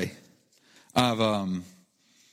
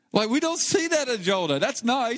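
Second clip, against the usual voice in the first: fervent preaching; 11 semitones higher than usual. Real, not acted. Pastor preaching, voice much raised.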